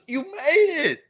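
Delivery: very high, delighted greeting; Ed overjoyed to see him. Retold or imitated.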